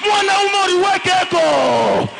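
loud, driving sermon delivery, French and Swahili; fiery preaching. Acted. Preacher rousing the congregation to rise, shouting. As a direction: preach it to the crowd at full force.

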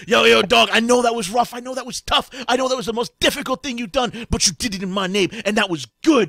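god talking like a laid-back bro; hip street-talking god. Acted. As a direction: hype him up like a streetwise deity slapping a shoulder. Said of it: "He goes".

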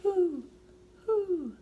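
high, loud hoots; owl hoot. Pretend. Showing the child how an owl hoots. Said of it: "How about an owl?".

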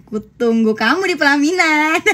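high, drawn-out teasing punchline; sing-song it slowly, about to laugh; imitating mom's punchline. Daughter repeating her mom's wedding joke, playfully drawn out. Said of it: "Itu jawaban dari mak gue".

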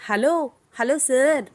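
stretched loud hello, hello, sir; loud calling out. Acted. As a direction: call him back to attention, loud and slow. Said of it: "ఎందుకంత గట్టిగా అరుస్తున్నావు".

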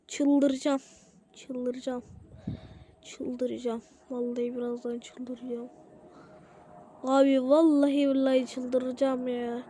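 low, very soft repeated mutter; muttered exasperation. Real, not acted. Telling himself he is about to go crazy, muttering. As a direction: mutter it under your breath, voice sunk low.

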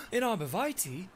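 put-on horrified old lady, breathless; a startled old lady's gasped question; gasping horror. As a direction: gasp it out in shock. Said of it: "she gasps and responds".

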